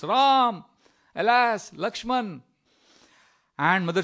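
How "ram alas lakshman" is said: loud, high cry of distress; acted shout. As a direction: call out as if desperate for help. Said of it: "he shouts".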